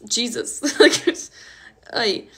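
loud laugh spilling into the word; real embarrassed laugh. Real, not acted. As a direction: laugh out the word through tears. Laughing through tears on stream.

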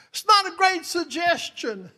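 loud, high proclamation; emphatic preaching shout. Real, not acted. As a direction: drive the line home at the top of your voice. Repeats the punchline at full force.

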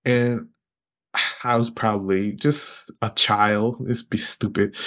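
usual speaking voice

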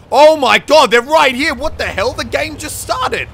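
loud high panicked yelp; shocked by sudden enemies; startled shout. Real, not acted. Enemies suddenly right there.

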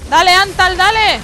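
loud, high cheer to attack; urgent shout. Real, not acted. Player urging Antal to hit the boss, urgent shout. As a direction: shout your teammate on. Urging teammate on mid-fight.

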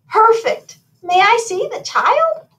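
high, loud fairy godmother voice; delighted fairy godmother. A narrator's reading. Fairy godmother asks to see the child, high eager voice. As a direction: ask eagerly, pitched right up.

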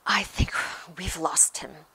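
out of breath, half-whispered; panting. A narrator's reading. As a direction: pant it out after a long run. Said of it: "Harry panted".

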